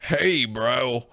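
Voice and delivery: exaggerated imitation of a failed Australian accent; mocking fake Australian accent. Co-host mimicking his friend's accent, mock bad Australian. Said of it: "you're literally going like".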